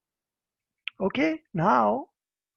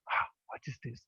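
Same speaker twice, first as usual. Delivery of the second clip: whispered, much softer gossip; 10 semitones lower, 9 dB quieter than usual. Retold or imitated. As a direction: lean in, hush it like a rumour. Gossiping villagers wondering aloud.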